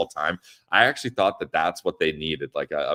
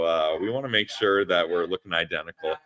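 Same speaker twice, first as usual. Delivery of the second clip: usual speaking voice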